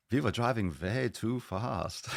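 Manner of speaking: exaggerated, flamboyant German impression. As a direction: stretch it out in a camp German accent.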